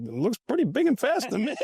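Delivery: high, wry aside of disbelief; mock disbelief. Doubting the coach's claim about Bettis, high mock disbelief. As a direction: scoff at the coach's claim under your breath.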